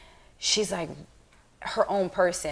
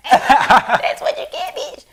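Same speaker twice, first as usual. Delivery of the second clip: louder, slow, laughing through it; gloating laugh; 8 semitones higher, 13 dB louder, much slower than usual. Retold or imitated. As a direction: cackle out a petty gloat. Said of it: "I'm like".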